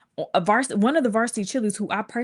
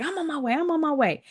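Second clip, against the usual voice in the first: hurried reassurance; 8 semitones higher than usual. Retold or imitated. Mom hurriedly answering, acted out.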